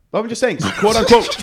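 laughing while saying it; stifling a giggle; trying not to laugh. Real, not acted. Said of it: "what's going on right now".

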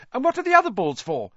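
high-pitched, quick-fire curiosity; curious young fan. A narrator's reading. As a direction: pester eagerly in a high boyish voice. Eager boy keeps asking questions.

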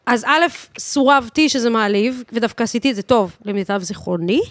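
ordinary delivery

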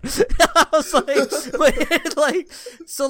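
high, real laughter through the words; laughing. Real, not acted. Friend still laughing after the proximity chat story. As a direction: speak through the laughing fit, slowly.

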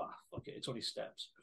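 imitating a friend's careless whisper; mock lazy whisper. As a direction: breathe it out like a shrug. Mimicking friend's lazy excuse.

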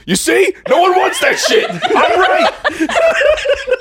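very high, loud outburst; hammed-up high-pitched yell; indignant yelling. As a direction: shout it in shrieking self-vindication. Lion turns around to argue.

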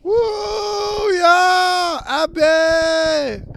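high excited cheer for a friend. Real, not acted. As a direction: whoop and call her name.

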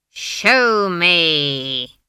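very drawn-out, loud icy order; icy command. A narrator's reading. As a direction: command it coldly, each word stretched long. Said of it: "her voice had ice in it".

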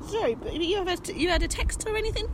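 lightly voiced receptionist, high and soft; high, hushed mock receptionist. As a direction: pitch it up softly, politely querying. Voices vaccine centre receptionist.

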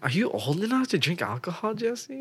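high-pitched imitation of a child; nosy little kids. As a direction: pester upward like a questioning kid. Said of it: "Those kids were like".

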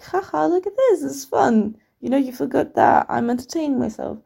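high-pitched, gloating mock taunt; imitating a cheeky, self-satisfied kid; smug. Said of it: "I wasn't being smug about it".

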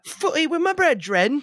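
mock British accent. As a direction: drawl it like a grand British gent. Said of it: "has a British accent".

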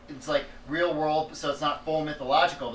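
usual speaking voice

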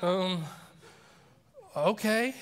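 hesitant, drawn-apart imitation of wary agreement; weirded out. Jacob invited to church, imitated weirded out. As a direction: pause uneasily, then lift into a reluctant okay. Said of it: "he's really weirded out by that request".

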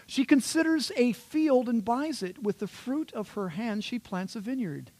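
high, swooping grand recital; hyperbolic superwoman reading. Shown as a demonstration. Preacher reads the ideal wife verses, hyperbolic grand recital. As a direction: proclaim the verse like a heroine's feats.

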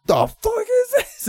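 very high, drawn-out squawk of disbelief; mock shock. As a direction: stretch it out sky-high, imitating bewilderment. Retold shock at the shrine.

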